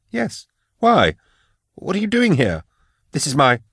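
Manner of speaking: high, alarmed, rising panic; frightened protest. A narrator's reading. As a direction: blurt it out scared and high. Frightened man confronting intruder.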